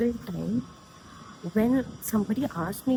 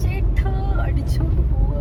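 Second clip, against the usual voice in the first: fast hushed whisper; 8 semitones higher, 5 dB louder, much faster than usual. Real, not acted. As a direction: whisper it quickly under breath. Quick whispered aside.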